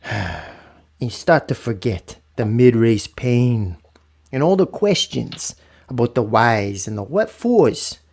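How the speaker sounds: slow, drawn-out reading. Acted. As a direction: stretch each word out slowly. Reading poem slowly.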